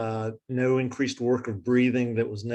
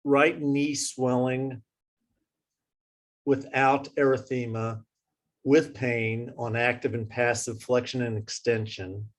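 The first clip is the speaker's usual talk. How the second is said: slowed down. Real, not acted. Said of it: "slowing down for you".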